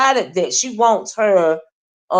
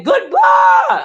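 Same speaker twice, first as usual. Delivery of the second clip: very high, loud shrieked goodbye; hollering goodbye impression; mock yelled goodbye; 5 dB louder than usual. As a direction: screech goodbye like her. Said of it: "if she was yelling one of them".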